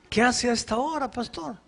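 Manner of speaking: high, astonished question; surprised chief. Retold or imitated. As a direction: chief startled to see the pastor at night. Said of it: "me dijo".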